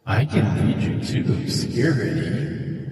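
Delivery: slow, hesitant otherworldly voice; eerie voice, about to leave; mysterious unseen stranger. Acted. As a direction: offer help as an eerie stranger. Game master voices the unseen stranger.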